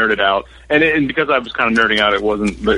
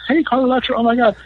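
high, breathless gush of a starstruck fan; mock fan gush, pitched way up; 11 semitones higher, faster than usual. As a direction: gush over her like a superfan. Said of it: "expecting me to gush and be like".

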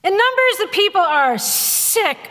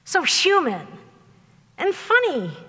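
usual speaking voice

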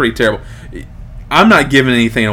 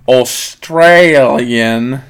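hammed-up, very drawn-out Australian accent; attempted Australian accent, louder and lingering; Aussie accent; 5 dB louder, much slower than usual. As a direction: stretch "Australian" slowly in a mock Aussie twang. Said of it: "Is that my Aussie accent".

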